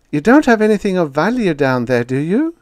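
calling out. A narrator's reading. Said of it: "she called".

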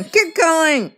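high, loud sudden command; shouted order. Pretend. Sending Fruity to find Spike alone, shouted order. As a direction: yell it to send someone off.

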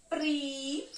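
"pri" clipped off as its own syllable; teacher marking a syllable for the class. Shown as a demonstration. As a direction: sound out "pri" as one beat. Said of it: "vou marcar a sílaba ao passo que eu vou pronunciando".

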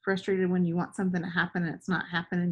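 plain reading voice

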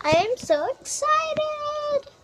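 high, slow, gushing delight; excited gush. Acted. Kid before the trip, high slow excited gush. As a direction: savour each word with delight.

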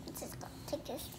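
hushed secret whisper. Real, not acted. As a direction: whisper so sister won't hear. Secret aside about sister.

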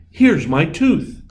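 fairy voice. A narrator's reading. Fairy finds tooth.

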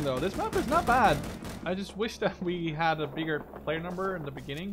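normal talking voice

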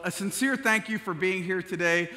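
normal talking voice

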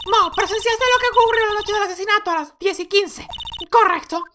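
very high put-on courtroom voice; haughty prosecutor. Prosecutor calls the next witness, high-pitched and formal.

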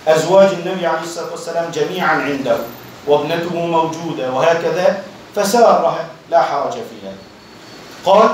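ordinary delivery

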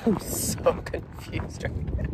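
soft whispered giggle through the words; breathless disbelieving laugh. Real, not acted. Repeating the complaint to viewers, whispered and laughing.

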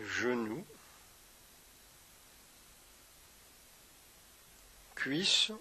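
unhurried meditation guide with long pauses; guided meditation. Acted. Yoga teacher guiding listeners through a body scan, guided meditation.

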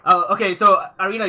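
usual speaking voice

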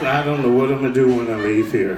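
low, heavy mock-gloomy voice; mock depressed. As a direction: moan it like a hammed-up depressive. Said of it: "They look depressed".

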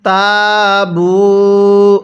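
তাবু with both vowels held one alif long; slow recited তাবু; stretched vowels. Shown as a demonstration. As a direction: draw out তাবু very slowly. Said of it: "এরপরে আমার সাথে পড়ুন".

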